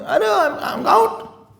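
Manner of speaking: high, flustered mock exclamation; fickle devotee. Preacher mocks a devotee quitting over gossip, high squeak.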